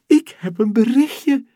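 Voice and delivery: high, loud, delighted; thrilled little astronaut; happy. Acted. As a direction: burst out with glee. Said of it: "zegt ze blij".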